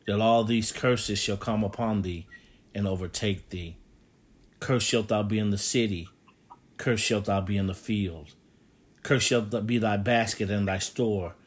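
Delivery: low, measured Bible reading; scripture reading. Acted. Preacher reads Deuteronomy to the congregation: solemn, low scripture reading.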